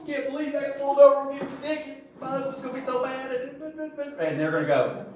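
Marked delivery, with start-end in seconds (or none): 0.1-3.3 high, fast, mock-upset wife voice; wife pulled over. Wife upset over a speeding ticket, high mock fretting. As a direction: imitate a wife fretting in a high-pitched whine. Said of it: "if my wife were ever to get pulled over".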